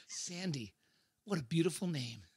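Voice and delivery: soft, smitten murmur; seductive. Acted. David flirting with Sandy, seductive. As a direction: savour the name softly. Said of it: "seductive saxophone emotion".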